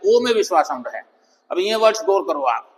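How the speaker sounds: ordinary delivery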